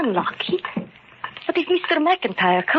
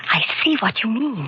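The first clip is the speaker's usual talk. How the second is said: normal talking voice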